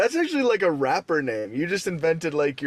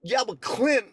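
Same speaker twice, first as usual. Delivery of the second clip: higher, hesitant mock voice; imitating Lat Molly; 6 semitones higher, slower than usual. Friend doing Lat Molly objecting, higher hesitant voice. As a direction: lightly voice a defensive friend. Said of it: "then he'd be like".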